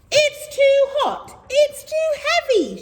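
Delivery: high, slow, whiny moan; moaning camel. Acted. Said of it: "she would moan".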